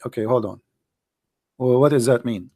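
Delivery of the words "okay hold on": ordinary talk, nothing marked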